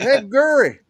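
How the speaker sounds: mock Southern Gurry, pitched high; friend calling Gurry. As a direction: imitate his old friend greeting him. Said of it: "that's what he still calls me".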